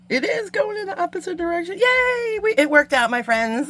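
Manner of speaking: excited cheer. Real, not acted. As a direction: celebrate the win out loud. Success after long struggle.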